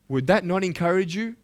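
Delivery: ordinary talk, nothing marked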